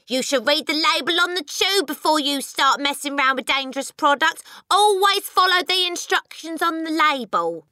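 high, loud nagging lecture; scolding wife. A narrator's reading. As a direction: lecture your husband shrilly and loudly.

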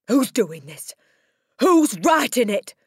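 loud, building to a high roar; angry yell. A narrator's reading. Headmistress yelling at nobody in particular. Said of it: "she yelled at nobody in particular".

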